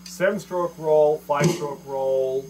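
Seven stroke roll, five stroke roll said slow and loud, each word weighted; emphatic naming. Shown as a demonstration. Teacher stressing the two key rolls, slow and loud. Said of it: "the two most important rolls".

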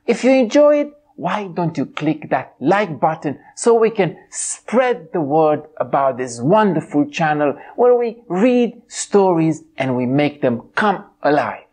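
ordinary delivery

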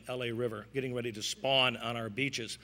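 ordinary delivery

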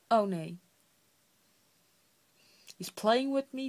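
startled gasp then loud; loud dismayed gasp; gasping alarm. Real, not acted. Hiccup returns, gasping. (geschrokken naar adem happend)

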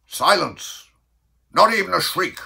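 loud, high command for silence; Bellman's cry. Acted. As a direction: call the room sharply to order. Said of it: "and the bellman cried".

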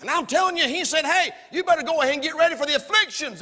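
loud, high, urgent warning; preaching shout. Real, not acted. Preacher warns church to get ready for afflictions, shouting. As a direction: belt it out to the congregation.

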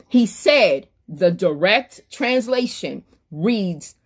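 ordinary talk

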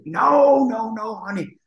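imitating Whitney. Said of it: "And I say".

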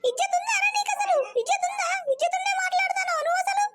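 high furious yelling at the husband; angry shout. Acted. Wife yelling at husband about his sister's dowry, furious shout. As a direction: yell it, outraged over the dowry money.

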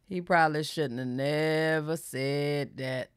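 slow, low, softened words; slow, deliberate. Real, not acted. Commenting on Corey's admission, slow and deliberate. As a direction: draw out "probably shouldn't have never said that" slowly and quietly. Slow, emphatic repeat of remark.

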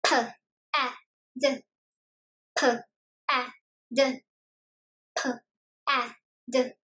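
slow, soft letter-by-letter P, A, D; sounding out words. Shown as a demonstration. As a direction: sound out P, A, D with pauses between. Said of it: "What word do these sounds make?".

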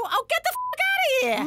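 high furious shout; screeching outburst at the phone; sudden shout. Acted. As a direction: explode at the caller. Mother suddenly swearing at caller.

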